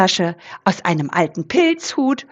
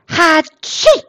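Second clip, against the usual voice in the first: a big booming Hatschi sneeze, pitched up; loud sneeze; 8 semitones higher, 5 dB louder than usual. Acted. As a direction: sneeze it out loud like a sick boar. Eberhard sneezes in his cave. (lautes Niesen)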